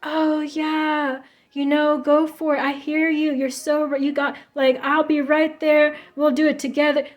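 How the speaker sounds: fake supportive friend. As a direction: ham up a fake eager yes-man. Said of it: "pretending to be this".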